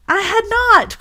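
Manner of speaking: high sharp angry "I had not"; sharp angry cry. Acted. Mouse crying out at Alice, sharp and angry. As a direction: snap it back at Alice, offended. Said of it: "cried the mouse, sharply and very angrily".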